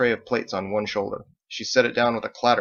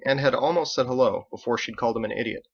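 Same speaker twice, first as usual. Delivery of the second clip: usual speaking voice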